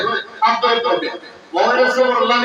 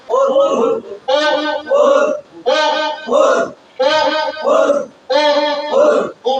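louder, higher "ও" called out again and again; loud drill call; 4 semitones higher, much slower than usual. Shown as a demonstration. Teacher leading the class in loud repeated calls. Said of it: "জোরে বলতে হবে আওয়াজ দিয়ে".

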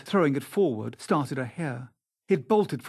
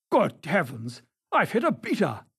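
shocked exclamation; 2 semitones higher than usual. A narrator's reading. Sir Geoffrey realising he has hit a beater, exclaiming. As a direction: exclaim in alarm. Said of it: "exclaimed Sir Geoffrey".

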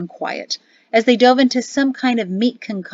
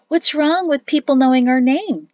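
nervous; 6 semitones higher, 5 dB louder than usual. Acted. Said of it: "Jatesi asked as she nervously".

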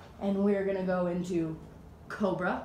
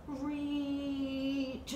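higher, long-held word; drawn-out stretching word; 5 semitones higher, 4 dB quieter than usual. Shown as a demonstration. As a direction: draw it out like a long reach.

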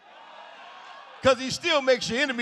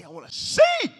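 desperate plea; 13 semitones higher than usual. Acted. Blind man begging the Lord for sight, high crying plea. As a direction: break it upward into a cry.

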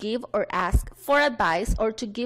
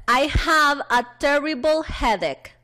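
slow, higher classroom model sentence; deliberate teacher reading for repetition; read-along model; 4 semitones higher, 4 dB louder, slower than usual. Shown as a demonstration. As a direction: lead the class through it, word by word. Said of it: "una última vez".

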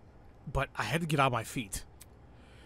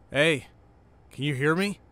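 calling louder to wake her; 6 dB louder than usual. Acted. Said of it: "I repeated the question".

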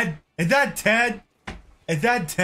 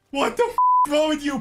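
normal talking voice

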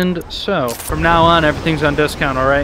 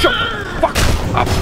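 strained high yell. Real, not acted. Player attacking a game enemy, strained high yell. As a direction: squeeze it out high while fighting.

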